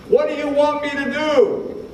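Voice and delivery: high, carrying call across a street; Jesus calling out to the blind men, high and carrying. Acted. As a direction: reach them over the crowd.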